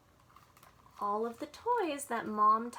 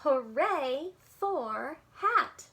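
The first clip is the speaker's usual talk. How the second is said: very slow, louder cheer; slow, louder elephant cheer; 3 semitones higher, 5 dB louder, much slower than usual. Acted. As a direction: cheer it out slowly together. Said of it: "Elephant cheered".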